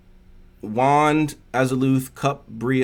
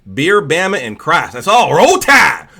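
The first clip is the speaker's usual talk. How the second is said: loud Southern chant building to a holler; mock Southern accent; 6 semitones higher, 10 dB louder than usual. Streamer making fun of Southern people, loud Southern accent. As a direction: start raised, finish yelling like a Bama fan. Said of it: "make fun of Southern people".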